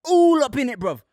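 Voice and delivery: bragging in a put-on London accent; London roadman accent. Repeating the bit for friends, in a mock London roadman accent. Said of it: "It's not okay to do accents anymore".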